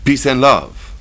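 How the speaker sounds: put-on high, loud pleading in thick French accent; heavy French accent. A narrator's reading. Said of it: "said in heavily accented English".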